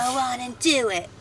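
sultry, suggestive delivery; salacious. Real, not acted. Co-host says the segment title salaciously. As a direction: say it like a dirty come-on. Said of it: "That sounded so salacious and dirty".

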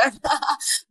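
a sudden high burst of laughter; bright embarrassed laugh. Real, not acted. Laughs after sharing her age.

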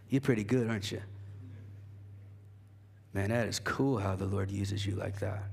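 low, soft, sly tempter voice; deep, quiet devil taunting the proud believer. Acted. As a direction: whisper flattery like the devil. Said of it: "that sounds, like, really deep".

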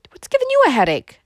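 high, loud fussy question; startled cousin. A narrator's reading. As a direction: pipe up sharply in alarm.

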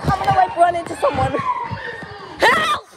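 high yelling for help; panicked yelling. Real, not acted. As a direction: yell out in alarm. Walking backwards, about to crash.

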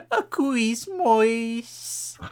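"Quismois" sung high and slow, kwis-moys; drawn-out crooned "A Quismois"; mock singer's Quismois. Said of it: "she basically just goes".

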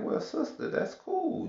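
sugary fake politeness that mocks her; lightly mocking sweet whisper; mock sweet reply. His sarcastic reply to the caller.

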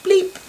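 very high squeaky bleep; hang-up bleep. Shown as a demonstration. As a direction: chirp it like a phone hanging up. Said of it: "like the hang up".

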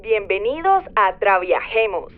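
cabin announcement voice, loud over music; flight attendant. Pretend. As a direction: welcome passengers like an airline captain. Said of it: "seré su capitana de vuelo".

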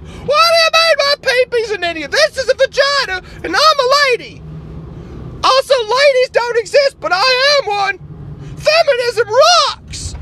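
high, loud indignant activist voice; outraged liberal caricature. Acted. Liberal character again.

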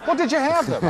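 blurted out high through a real laugh; laughing question. Real, not acted. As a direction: let the laugh break into the words. Laughing at his own question.

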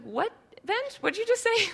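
high-pitched, incredulous question; squeaky mock bewilderment; baffled. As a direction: ask in amused disbelief, voice way up. Acts out baffled reply to child.

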